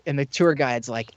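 ordinary talk

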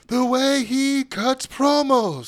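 high, slow drawn-out promo voice; imitating Marcel Bartel. Podcaster mimicking Bartel's promo cadence, slow and high. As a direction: stretch it out like a wrestling heel. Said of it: "Marcel Bartel always gets me".

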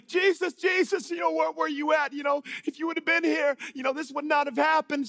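high, loud, pleading wail in imitation; imitating distraught crying. Mary runs up to Jesus after her brother's death, mock crying. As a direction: act out a grieving sister's upset cry. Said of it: "she's crying".

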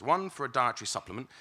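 usual speaking voice

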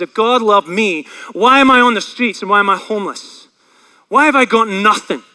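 loud, bitter challenge, retold; acted indignant outburst; angry. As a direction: throw the questions out as angry accusations. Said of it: "He was angry".

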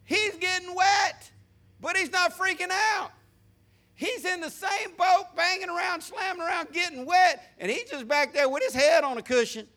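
high, forceful pulpit voice; impassioned preaching. Real, not acted. Preacher to congregation about a man calm in the boat, high-pitched preaching.

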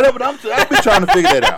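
high, fast and laughing; host cracking up over accents. Real, not acted. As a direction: talk through a laugh.

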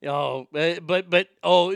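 ordinary talk

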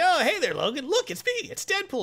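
high-pitched, cheery put-on greeting; Deadpool. Mercenary greeting Logan, high comic hello.

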